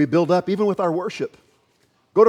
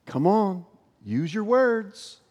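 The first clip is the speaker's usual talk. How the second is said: slow mock-impatient jab, pitched up; rude, arrogant; 5 semitones higher, much slower than usual. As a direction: imitate a condescending customer, higher and drawn out. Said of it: "so rudely, so arrogantly said".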